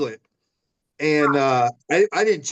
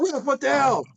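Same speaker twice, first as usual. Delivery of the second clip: retold protest to the ref; 11 semitones higher than usual. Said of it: "I go".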